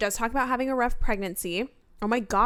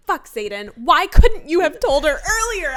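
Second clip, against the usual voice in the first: mock-furious, louder and higher; frustrated outburst; 6 semitones higher, 8 dB louder than usual. Reader yelling at Xaden for waiting too long, mock anger. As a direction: vent at the character like you're reading it. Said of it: "I remember reading that and being like".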